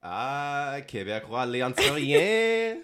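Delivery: high, drawn-out Quebecois French put-on; mock Quebecois accent. Podcaster teasing the hyped accent, exaggerated Quebecois. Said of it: "hyping up the Quebecois accent".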